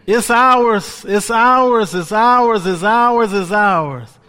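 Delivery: loud, rising preacher's cry; preaching at full force. Acted. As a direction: preach it hard to the congregation. Preaching to the church.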